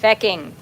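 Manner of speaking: fecking said with a flat Irish a. Retold or imitated. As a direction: imitate the Irish swear, fecking. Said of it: "I cannot say taking when I'm doing a Transylvanian accent".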